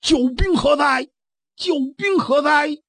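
slow, high, drawn-out summons; shouting for help. Acted. Brother summons his hidden helper, slow high shout. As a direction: call the hidden reinforcements with a long cry. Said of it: "高声喝喊".

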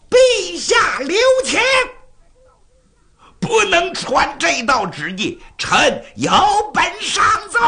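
high, ringing plea called up from below; loud shout. Acted. Minister calling up to the king to spare maids, loud shouting. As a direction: cry out urgently to halt an order. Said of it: "有人高声喊道".

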